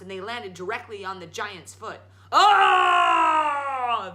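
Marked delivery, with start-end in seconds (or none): 2.3-4.1 loud high yelp of pain; pained giant yell. Acted. Giant landed on by Jack, loud yell. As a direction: howl as your toe is crushed. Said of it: "the giant yelled".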